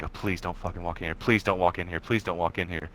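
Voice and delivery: low, soft repeated plea; hushed pleading. Retold or imitated. Boy hiding in bedroom as parents pass, hushed retold pleading. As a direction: beg under your breath, as retold.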